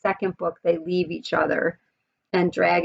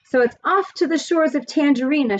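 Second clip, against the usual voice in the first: higher, excited call of "off to the shores"; shouted; 8 semitones higher, 3 dB louder than usual. Acted. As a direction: call it out across the sky, "off" rising high. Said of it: "shouted my father".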